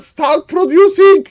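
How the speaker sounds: high, loud pleading yell; exasperated shout. Acted. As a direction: scream at him to spend the gold. Caster urging player again.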